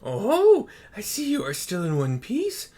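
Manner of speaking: lightly voiced elder turtle greeting; Genbu voice.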